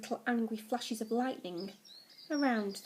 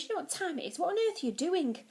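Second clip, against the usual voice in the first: higher, exasperated questioning; groaning exasperation; 7 semitones higher than usual. A narrator's reading. Dad catches his son up early, groaning exasperation. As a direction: pitch it up in mild disbelief. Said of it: "he groaned".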